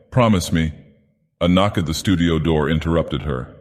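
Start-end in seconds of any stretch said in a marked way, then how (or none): none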